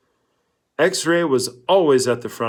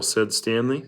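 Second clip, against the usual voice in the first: plain reading voice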